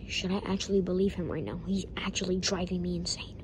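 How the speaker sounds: unvoiced aside whisper; whispered aside so the kid won't hear. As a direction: hiss it under your breath.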